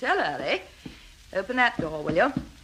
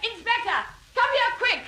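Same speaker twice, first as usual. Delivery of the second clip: urgent shout; 13 semitones higher than usual. Acted. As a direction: yell it out urgently. Calling out for help.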